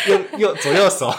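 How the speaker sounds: loud, high laughing reply. Real, not acted. Husband joking back to teasing wife, loud laughing.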